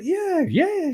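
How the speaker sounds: high, eager yeah yeah; eager yes. Retold or imitated. Retold to a podcast host, a high eager yes. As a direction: jump at the offer, squeaky high. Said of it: "And I was like".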